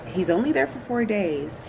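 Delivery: normal talking voice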